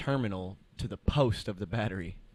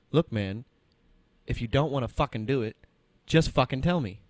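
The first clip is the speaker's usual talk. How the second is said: louder mock-angry customer snapping; angry retort; 4 semitones higher, 8 dB louder than usual. Rude customer cursing at the tech, imitated anger. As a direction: give the tech attitude, louder. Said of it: "word for word".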